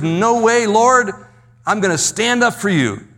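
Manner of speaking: high, insistent refusal; emphatic protest. Retold or imitated. Peter promising Jesus he will stand by him, high emphatic protest. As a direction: protest loyally with the pitch pushed up.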